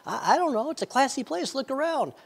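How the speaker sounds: wife's high, light voice; Becky. Acted. Wife answers husband about the classy place, high and light. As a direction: pitch it up high for the wife's reply. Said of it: "Becky, why do you think that?".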